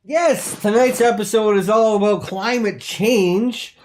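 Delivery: loud, high, slow host announcement; host steering back to the topic; host relaunch. Acted. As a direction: reset the show with big energy.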